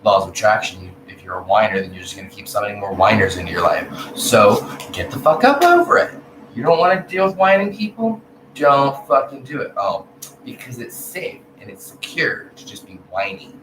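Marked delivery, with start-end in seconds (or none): 4.2-9.7 louder, higher telling-off; real exasperated scold. Real, not acted. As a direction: snap them out of whining. Telling whiners off.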